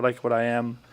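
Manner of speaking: ordinary talk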